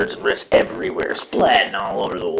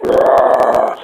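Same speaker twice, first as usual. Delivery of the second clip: mock roar; 5 semitones higher, 10 dB louder than usual. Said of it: "they were like".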